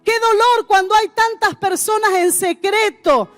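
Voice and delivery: normal talking voice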